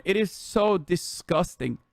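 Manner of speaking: ordinary talk, nothing marked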